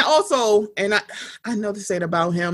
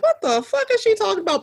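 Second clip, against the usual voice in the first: pitched way up in disbelief; incredulous outburst; 11 semitones higher, faster than usual. Retold or imitated. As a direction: blurt it out, baffled. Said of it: "I'm sitting here like".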